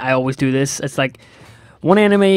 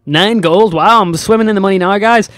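loud, higher sarcastic gloating; mock excitement; 5 semitones higher, 5 dB louder than usual. Gamer mocking a tiny loot haul, loud sarcastic cheer. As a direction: ham up being rich over nine gold.

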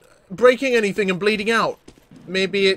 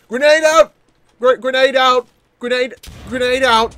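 calling out; 4 semitones higher, 6 dB louder than usual. Real, not acted. Calling out grenade throw.

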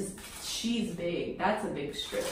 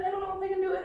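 high whine breaking into laugh-crying; half sob, half laugh, losing nerve; laugh-crying panic; 9 semitones higher, much faster than usual. Real, not acted. Panicking before pulling strip.

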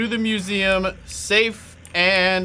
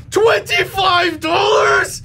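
high, loud, slow indignant yell; outraged shout; 14 semitones higher, 6 dB louder, much slower than usual. Real, not acted. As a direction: stretch it out in disbelief. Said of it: "I am so mad".

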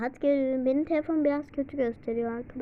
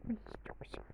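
whisper; 3 semitones lower, 20 dB quieter, much faster than usual. Real, not acted. Admiring outfit.

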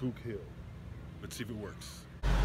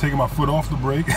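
ordinary talk

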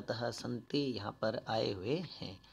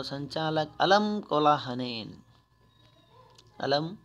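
slow, louder recited reading; Sanskrit recitation; 3 semitones higher, 8 dB louder, slower than usual. Shown as a demonstration. Teacher reading the organiser's Sanskrit line to pupils, louder. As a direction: declaim the line like a text reading. Reads Sanskrit text aloud.

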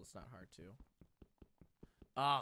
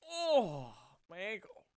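mock pain yelp; 7 semitones higher, 8 dB louder, slower than usual. Character falls, hurts ankle.

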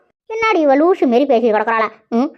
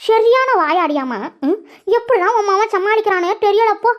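usual speaking voice

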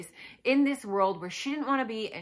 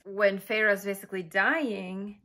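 ordinary delivery

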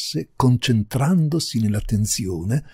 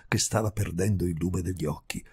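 ordinary delivery, nothing marked

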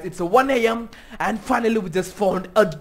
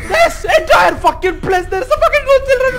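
frantic yell; 14 semitones higher, 12 dB louder than usual. Real, not acted. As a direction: shout it out, half panicked. Yelling at creepy game dolls.

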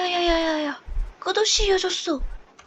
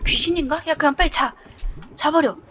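usual speaking voice

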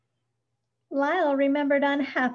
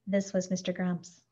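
plain reading voice

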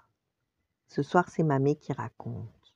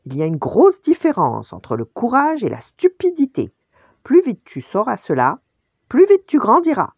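loud, raised, emphatic father's reprimand; thundering scolding; 9 semitones higher, 9 dB louder than usual. A narrator's reading. Furious Mufasa lecturing his son, thundering. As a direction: scold forcefully, hitting the key words. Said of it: "tonna Mufasa".

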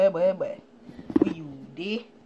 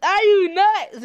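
high, loud, slow disbelief; outraged can't-believe-it; incredulous; 10 semitones higher, 9 dB louder, slower than usual. Real, not acted. Refusing more ingredients.

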